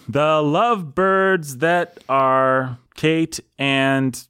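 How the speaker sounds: "The Lovebirds that are Kate and" dragged out beat by beat; metrical scansion. Shown as a demonstration. Host fitting listeners' names into meter, slow scansion. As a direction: count the syllables slowly aloud.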